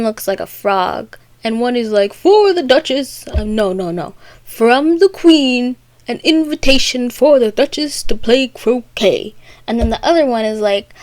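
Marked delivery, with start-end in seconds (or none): 2.1-3.3 loud, high herald's call; announcing footman. Retold or imitated. Footman delivers an invitation, loud mock proclamation. 4.4-9.3 higher, stately announcement, opening words stretched; proclaiming footman. Retold or imitated. First footman proclaims the invitation.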